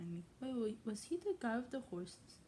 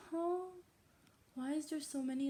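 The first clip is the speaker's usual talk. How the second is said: ordinary delivery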